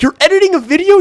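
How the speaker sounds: very high, incredulous rising "video"; mock disbelief. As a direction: squeak it out in disbelief. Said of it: "And he was like".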